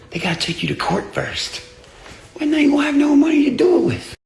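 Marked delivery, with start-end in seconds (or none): 2.3-4.1 much higher, louder, fast shout; gloating shout. Real, not acted. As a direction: shout the punchline triumphantly. Gloating punchline about lawsuit.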